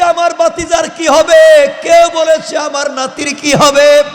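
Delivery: high, loud imitation of relatives crying out; ringing retold lament; retold outcry. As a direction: act out alarmed cries in a preacher's chant. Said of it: "কান্না শুরু করে দিলেন".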